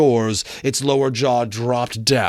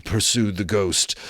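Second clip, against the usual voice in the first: usual speaking voice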